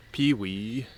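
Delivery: whistled pewee bird call, rising at the end; bird call imitation. Shown as a demonstration. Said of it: "sounds like it's saying pewee".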